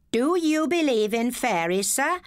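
high, overly polite schoolboy voice; mock respectful. A narrator's reading. Cheeky pupil mock-respectfully asks the teacher, high and polite. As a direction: lay on the respect to tease the teacher. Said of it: "in a voice so respectful".

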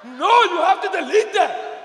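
loud, high, drawn-out plea; loud protest. Acted. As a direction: protest in comic horror at full volume. Son reacts in horror to comment.

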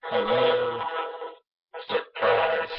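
ghostly robotic voice changer. Pretend. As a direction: electronic echoing ghost voice. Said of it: "add a robot voice".

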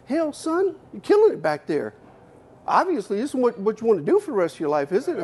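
high-pitched Texas boss, pleased. Retold or imitated. Owner praises the star proofer in his office, high country voice. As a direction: play the folksy owner praising the kid. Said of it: "He goes".

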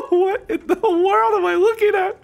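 high-pitched laughing disbelief. Real, not acted. Gamer baffled by what he sees, laughing. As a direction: talk through a real laughing fit. Cracking up at game.

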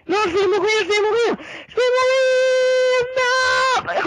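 high drawn-out cry of despair; panicking gamer. Real, not acted. About to die in game.

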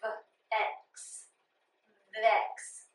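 V and X apart, then vex; sounding out vex. Shown as a demonstration. Teacher segmenting the last syllable of convex. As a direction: split v from x, then blend vex. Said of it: "the vowel is short".

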